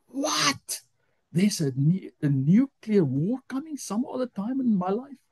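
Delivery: high-pitched, astonished question; retold disbelief, pitch leaping up; incredulous. Hears of nuclear war in his lifetime, incredulous.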